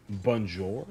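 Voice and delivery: put-on French said in a thick American accent; lightly hammed-up American accent mangling French; broken American accent. Frozen student greets French teacher, broken American accent. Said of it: "in the most broken American accent".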